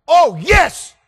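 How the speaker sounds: very high, loud whoop; excited shout. Retold or imitated. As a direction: burst out like a thrilled kid. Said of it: "He was so excited".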